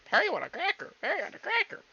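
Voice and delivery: high, squawky parrot; playful high-pitched parrot imitation. Parrot phrase done as impression.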